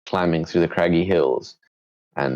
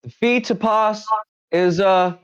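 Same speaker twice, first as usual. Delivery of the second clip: bandit voice; 3 dB louder than usual. Acted. Bandit answers John's claim, high loud voice. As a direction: refuse them in the squeaky bandit voice.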